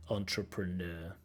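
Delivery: "Entrepreneur" said slowly and softly with the stress on the final syllable; slow, soft model of "Entrepreneur", stress on -NEUR. Shown as a demonstration. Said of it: "Stress on the last syllable".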